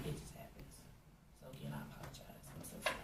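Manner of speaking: almost no voice, fading into tears; whispered apology. Real, not acted. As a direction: let the sorry dissolve into a breath. Tearful apology to the victim.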